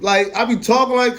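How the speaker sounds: high, loud hyped-up talk; amped-up demo of hyper talk. Shown as a demonstration. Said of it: "like, hyper with everybody else".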